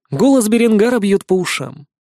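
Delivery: plain reading voice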